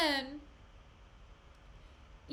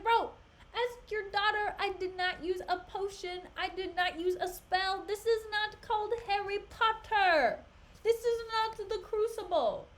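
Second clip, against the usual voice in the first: a mock-exasperated Othello, pitched much higher; 8 semitones higher than usual. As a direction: play Othello fed up with the accusations. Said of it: "And Othello's like".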